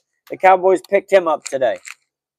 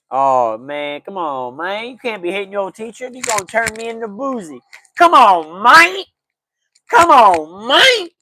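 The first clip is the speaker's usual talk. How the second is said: Boozy impersonation; 4 dB louder than usual. Retold or imitated. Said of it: "That's my Boozy impersonation".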